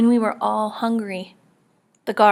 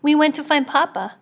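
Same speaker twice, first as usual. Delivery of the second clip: shaky voice; 7 semitones higher, 6 dB louder than usual. A narrator's reading. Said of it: "His voice shook".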